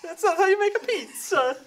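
laughing mock Italian. Repeats pizza line laughing.

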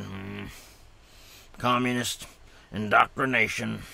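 mildly worked-up character voice, broken into emphatic chunks; halting, mock-agitated script reading with punched words; getting upset. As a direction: let the script rile you a little, word by word. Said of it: "getting upset".

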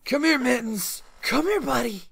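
high sing-song call to the puppy; cooing at a little dog to come; coaxing dog call. Real, not acted. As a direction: coax the dog over sweetly. Calling the little dog over.

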